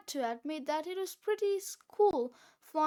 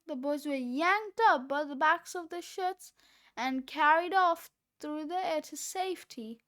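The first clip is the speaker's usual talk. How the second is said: plain reading voice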